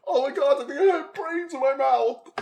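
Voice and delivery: shocked. Retold or imitated. Reacting to friend shot in head.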